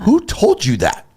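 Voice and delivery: high, indignant Who told you that, hit on who; imitating someone offended, high pitched; mock indignant who. Gossip target snaps back, high and indignant. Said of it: "What's the first thing they're gonna say".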